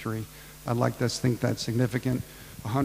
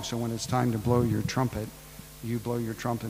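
ordinary talk

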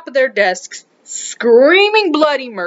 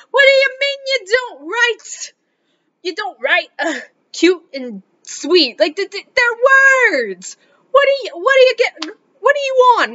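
much higher, exasperated disbelief; incredulous; 10 semitones higher than usual. Retold or imitated. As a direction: ask like you can't believe your ears. Teacher incredulous at boys' refusal.

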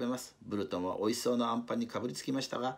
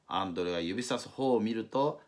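usual speaking voice

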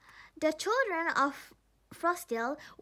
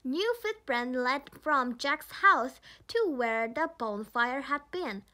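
ordinary delivery

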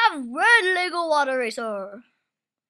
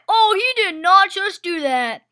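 usual speaking voice

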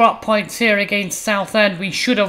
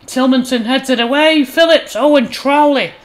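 higher, louder burst of match commentary; excited commentary; 5 semitones higher, 6 dB louder than usual. Real, not acted. Commentator calling a sudden goalmouth save, excited. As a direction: call the save with rising urgency.

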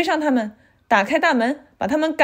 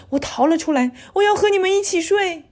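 rising to a high, urgent plea; high childlike voice; scared child pleading; 8 semitones higher than usual. A narrator's reading. Scared child begs to share bed.

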